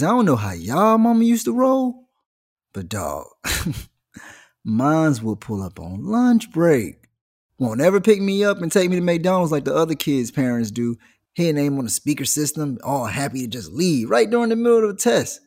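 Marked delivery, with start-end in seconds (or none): none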